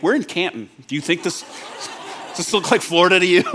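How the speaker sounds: put-on half-laughing, teasing reply to the kids; amused dad answering the kids, chuckling; laughing retort. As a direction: tease the kids through a laugh. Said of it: "I'm like".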